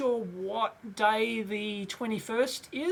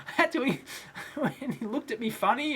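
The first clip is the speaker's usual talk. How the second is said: ordinary talk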